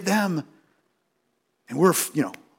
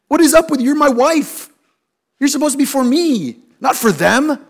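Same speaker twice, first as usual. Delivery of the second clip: indignant inner thought; 6 semitones higher, 4 dB louder than usual. Retold or imitated. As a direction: huff at the wife in mock offence. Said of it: "What I'm thinking is".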